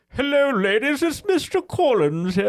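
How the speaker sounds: high, loud, hammed-up stage accent; weird Mister Collins accent. Shown as a demonstration. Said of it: "this is literally my accent".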